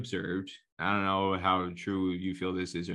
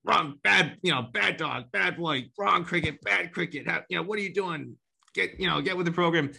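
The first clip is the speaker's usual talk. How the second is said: wag your finger like at a bad dog; finger-wagging scold; 8 semitones higher than usual. Mocking cricket critics scolding kids, high finger-wagging tone. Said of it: "I'm gonna wag my finger".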